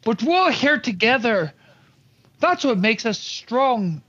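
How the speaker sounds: ordinary talk, nothing marked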